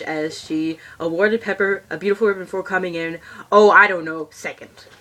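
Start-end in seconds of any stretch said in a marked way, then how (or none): none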